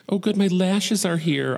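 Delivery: high, giddy relief at a delivery; delighted. Retold or imitated. Speaker to partner about the lashes package, delighted.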